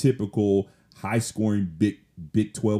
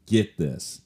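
ordinary delivery

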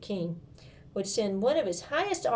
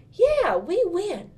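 high, loud, slow cheer; little sister cheering back at her brother; happy echo; 11 semitones higher, 8 dB louder, much slower than usual. Acted. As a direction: savor the words like a victory chant. Girl echoes brother happily.

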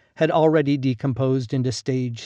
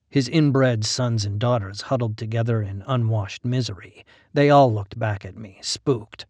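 plain reading voice

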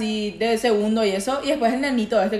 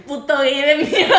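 laughing mock insult; 5 semitones higher, 5 dB louder than usual.

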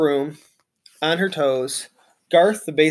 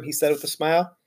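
usual speaking voice

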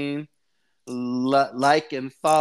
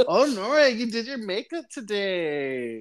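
gushing over her makeup, higher; hammed-up compliment; 8 semitones higher than usual. Voicing Craig complimenting Nora.

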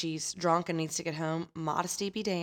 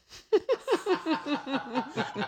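big laugh; 9 semitones higher than usual. Real, not acted. Host laughing at her own funny story. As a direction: crack up at the story.

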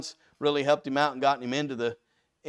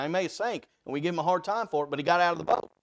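ordinary talk, nothing marked

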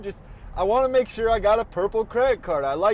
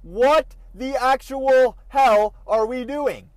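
slow, louder, disbelieving outburst; exasperated rant; 5 semitones higher, 7 dB louder, slower than usual. Real, not acted. Driver ranting at the parked Range Rover, furious. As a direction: stretch out every word in outrage. Car blocking the intersection.

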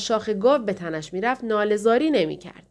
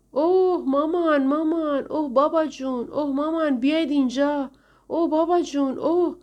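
put-on whining children; 6 semitones higher than usual. Heathcliff mimicking the Linton children calling their parents, high mock wailing. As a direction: ham up spoiled kids howling for their parents.

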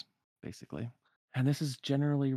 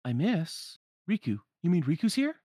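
higher, louder startled questions; surprised questioning; 8 semitones higher, 7 dB louder than usual. Acted. Sora learns Riku may be here, surprised and rising in volume. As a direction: build from puzzled to excited disbelief.

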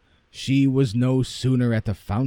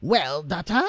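higher, expectant call to daughter; mother's pitched-up greeting; eager mother; 7 semitones higher than usual. Acted. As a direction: call out hopefully to her daughter. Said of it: "she cried out".

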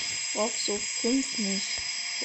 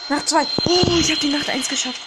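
loud relieved cheer; excited shout; 3 semitones higher, 10 dB louder than usual. Real, not acted. Survived the night, shouts relief.

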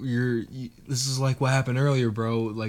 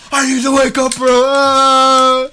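high desperate scream; 14 semitones higher, 13 dB louder than usual. Acted. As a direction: scream to wake up. Screaming to wake up.

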